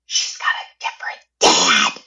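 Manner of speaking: confused mother voiced in a whisper; hushed mom quote. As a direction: hush it like a secret slipping out. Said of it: "she said it like Spicy Mom".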